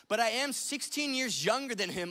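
ordinary talk

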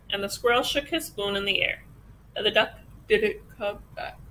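usual speaking voice

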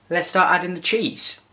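ordinary talk, nothing marked

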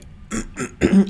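loud rough throat clearing. Real, not acted. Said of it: "mein Hals auch nochmal normal".

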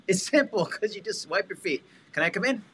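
chuckling while acting out the visit; laughing. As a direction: laugh through a cheerful knock-knock line. Acting out agent at the door.

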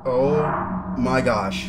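loud, very slow cry of alarm; long, loud horror-game gasp of words; real startled shock. Real, not acted. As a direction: drag each word out loudly in shock.

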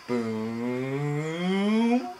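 long boom gliding upward; slow rising whoosh of a boom; imitated explosion. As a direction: stretch out boom with a rising pitch.

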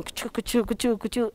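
rhythmic choo-choo patter; brisk percussive chugs; train chugging. Acted. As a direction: imitate a train setting off. Imitating the departing train.

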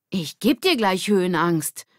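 mock-threaten him; playful threat. Lilly playfully threatening Ole.

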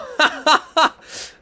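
squealing laugh of disbelief; amazed laughter. Real, not acted. Laughs at character's outburst.

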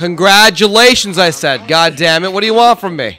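loud, fed-up outburst; exasperated yell. Real, not acted. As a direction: shout it, totally fed up. Friend mocks his missed enemy.

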